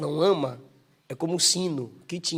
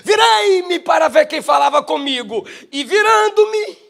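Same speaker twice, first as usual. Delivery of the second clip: loud and high, the last words slow; loud proclamation; 11 semitones higher, 12 dB louder, slower than usual. Acted. Preacher proclaims John's words to the church, loud and high. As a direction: call it out like a trumpet.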